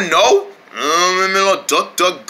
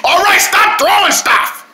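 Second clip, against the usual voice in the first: high, exasperated mock-angry yell; annoyed yell; 9 semitones higher than usual. Character pelted with objects, high annoyed shout. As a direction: call out to an unseen pest.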